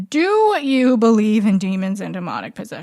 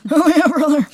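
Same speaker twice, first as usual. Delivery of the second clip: louder, higher bro cheer; hype bro shout; 6 semitones higher, 5 dB louder than usual. Shown as a demonstration. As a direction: pump it up like a gym bro.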